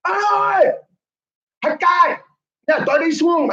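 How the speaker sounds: high-pitched retold holler; calling down. Customer on the rooftop hailing the vendor, calling down. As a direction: yell down as if to someone in the street. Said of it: "I'll just yell down".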